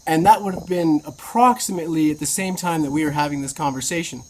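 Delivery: normal talking voice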